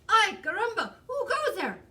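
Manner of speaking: loud, high Spanish-flavoured challenge; excitable little swordsman's shout; bold kitten hero. A narrator's reading. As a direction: call out the challenge at high pitch.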